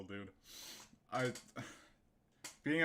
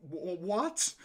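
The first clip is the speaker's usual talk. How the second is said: high, louder stammered what; stammering shock; 7 semitones higher, 6 dB louder, slower than usual. Real, not acted. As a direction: stumble over it in disbelief. Stunned by the finish.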